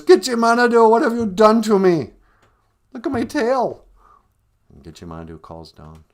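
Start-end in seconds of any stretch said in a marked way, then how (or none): 0.0-2.1 very high, very loud outraged shout; cursing. Acted. Beaver blaming Gitchimanadu for his ruined tail, cursing. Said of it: "And he curses". 2.9-3.8 high, loud outraged cry; cursing. Acted. Beaver showing Gitchimanadu his ruined tail, cursing. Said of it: "And he curses".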